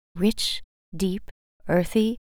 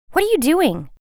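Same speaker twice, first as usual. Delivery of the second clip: sharp butterfly; 9 semitones higher, 5 dB louder than usual. Acted. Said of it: "she hissed".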